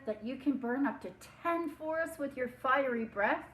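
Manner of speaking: high, loud and eager; butter him up with bright eagerness; eager flattery. Acted. Princess flattering the dragon, high and eager.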